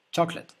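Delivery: Chocolate, choc-let, clean E; English pronunciation. Shown as a demonstration. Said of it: "C'est comme un E". (Chocolate, finale en E)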